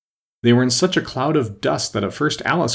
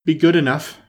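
high, broken phrase, winded from running; out-of-breath plea; panting; 7 semitones higher, 4 dB louder than usual. A narrator's reading. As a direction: pant it out between breaths. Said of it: "Alice panted out after running a little further".

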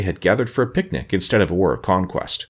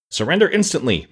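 a mock-stern demand, higher and pushed; 7 semitones higher than usual. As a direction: demand surrender like a girl playing the fierce general. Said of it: "frowning as terribly as her pretty face would allow".

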